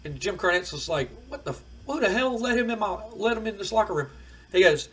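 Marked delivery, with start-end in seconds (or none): 1.3-4.1 high-pitched mock outrage; indignant disbelief. Manager finds an unwanted visitor backstage, indignant disbelief. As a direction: act put out and baffled at the intruder.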